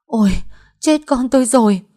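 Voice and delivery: high, loud wail of panic; anguished cry. A narrator's reading. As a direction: cry out in horror for her child. Mother hears daughter is hemorrhaging.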